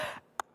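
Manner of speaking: sharp surprised intake of breath; dramatic gasp. Shown as a demonstration. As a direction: gasp as if shocked. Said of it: "especially when I say".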